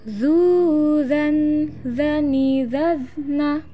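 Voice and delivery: slow recitation chant; drawn-out teaching drill; swung, held syllables. Shown as a demonstration. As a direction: stretch vowels and hold the nasals. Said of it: "tahan suaranya".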